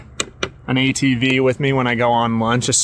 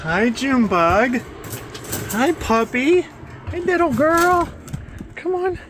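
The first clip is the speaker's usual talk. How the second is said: much higher cooing puppy voice; 12 semitones higher, 4 dB quieter than usual. Real, not acted. Greeting her puppy in much higher baby talk. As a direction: coo at the pup, pitched way up.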